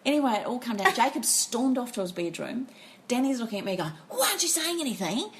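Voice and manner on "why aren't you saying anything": imitated exasperated partner. Imitates exasperated partner challenging her.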